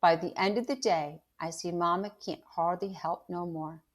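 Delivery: reading a chapter aloud to the class; steady storyteller voice, acting out the quotes; story narration. A narrator's reading. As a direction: read aloud to students. Teacher reads novel to class.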